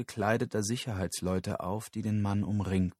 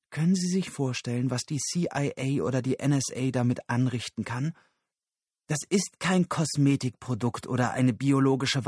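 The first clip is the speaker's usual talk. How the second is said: higher, indignant voice, pressing on; agitated Susan; 4 semitones higher, 3 dB louder than usual. Acted. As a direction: argue urgently, starting with a raised demand. Susan argumentiert aufgebracht. (aufgebrachte, drängende Frauenfigur)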